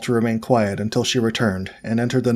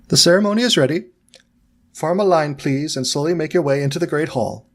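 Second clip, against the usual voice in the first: brisk professor; 5 semitones higher than usual. Acted. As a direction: call the class into line, crisp and commanding. McGonagall summons the students.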